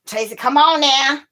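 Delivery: high, loud straining at a jar; pleading with a tight lid, loud; opening a stuck jar. Real, not acted. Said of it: "Open this jar".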